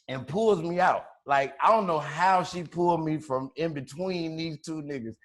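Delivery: ordinary talk, nothing marked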